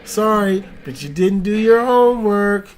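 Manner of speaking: high, loud scolding teacher voice; mock teacher. Mock teacher scolding a held-back kid, high and loud. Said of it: "I feel like that kid".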